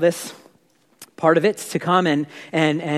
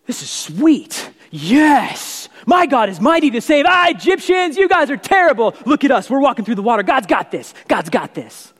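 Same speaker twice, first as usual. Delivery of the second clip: excited faithful Israelite; 5 semitones higher than usual. Retold or imitated. Israelite crossing the Red Sea, gloating at the Egyptians, excited cheering. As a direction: cheer and taunt the Egyptians with glee. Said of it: "some of the people are walking through going".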